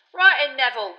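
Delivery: high, loud cheery bus conductor; brash, cheerful young conductor calling out; Stan voice. A narrator's reading. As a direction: belt it out like a chirpy Cockney conductor. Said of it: "said Stan, clapping his hands".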